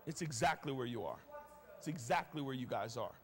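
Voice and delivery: low, soft and earnest; sober aside. Real, not acted. Preacher telling the church it's their situation, soft and sober. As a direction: drop low and bring it home. Preacher turns softly to congregation.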